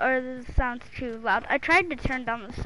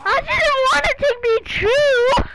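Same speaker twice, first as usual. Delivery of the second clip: high, loud, slow crying wail; mock dramatic wail; 11 semitones higher, 11 dB louder, slower than usual. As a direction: ham up the heartbreak.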